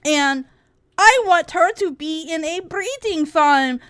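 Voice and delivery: high, loud, whiny mocking voice; mocking Anna. Streamer mocking a critic's words in a whiny voice. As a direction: sneer it out as a nasty caricature. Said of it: "Anna says".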